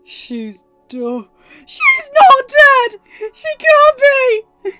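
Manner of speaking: high, loud, slow sobbing denial; crying shout. Acted. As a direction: shout the denial through tears. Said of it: "Ryan shouted".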